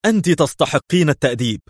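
high-pitched angry threat; worked-up indignant cry; tense shout. A narrator's reading. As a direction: lunge into the threat, voice lifted. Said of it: "واندفع نحوها مستطردا".

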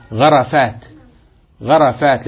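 غرفات said gharafaat, fatha on the ra; غرفات with an a after the r. Shown as a demonstration. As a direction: open the ra in غرفات: gha-ra-faat. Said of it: "ليس غرفات".

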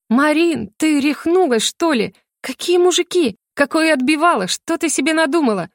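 high-pitched, indignant disbelief; astonished. A narrator's reading. Larisa rejecting her sister's accusation, astonished. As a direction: question it as if it were madness. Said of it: "с безграничным удивлением".

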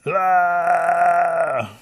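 high, loud, long held cry; mock hollering wail. A narrator's reading. Rabbit still hollering in the bushes, wailing loudly. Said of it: "He's still hollering".

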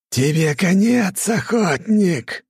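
high, slow, loud god's roar; growled threat. Acted. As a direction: bark it slowly as a vengeful god. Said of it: "рявкнул". (медленный громкий рык бога)

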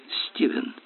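ordinary delivery

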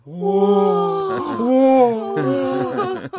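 high, loud gushing exclamations; effusive delight. Acted. Manilov gushing with delight at his guest. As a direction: gush over the guest with joy.

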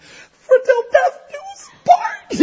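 mock crying through vows. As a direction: blubber out the vow through tears. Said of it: "I'm just crying".

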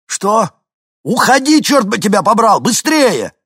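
high, loud panicked yelling; frantic shout. A narrator's reading. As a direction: yell it in panic. Hinkin frantic warning.

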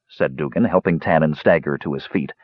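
plain reading voice

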